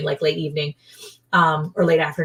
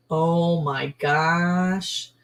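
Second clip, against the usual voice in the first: normal talking voice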